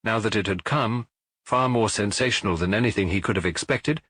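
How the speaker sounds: ordinary delivery, nothing marked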